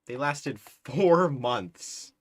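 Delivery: slow, loud, drawn out in amazement; amazed disbelief. Real, not acted. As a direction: stretch each word in disbelief. Amazed at war length.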